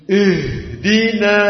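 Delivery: slow "Ihdina" with a heavy, thickened "Ih"; wrong thick ha. Shown as a demonstration. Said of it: "Jangan dibaca dengan tebal".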